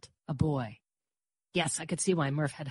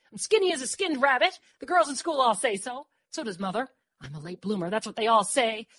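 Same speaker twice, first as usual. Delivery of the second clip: higher, eager babble; words tumbling out; 6 semitones higher, 4 dB louder than usual. A narrator's reading. Dor argues she could pass as boy, tumbling out. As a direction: pour out your reasons. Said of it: "Words tumbled out".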